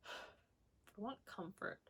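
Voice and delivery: soft, slow, sighed wish; sighing softly. Real, not acted. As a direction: sigh it out, drawn out. Sighing, longing for comfort.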